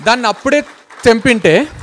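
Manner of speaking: loud, punched warning; god insisting loudly on అప్పుడే; loud emphatic. Acted. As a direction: hit it hard and loud. God stressing the point.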